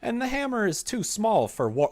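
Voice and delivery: loud, swooping mock indignation; theatrical comment reading. Streamer reading a viewer's hammer complaint, loud and theatrical. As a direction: read it out big, with high leaps in pitch.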